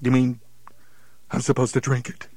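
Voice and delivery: ordinary talk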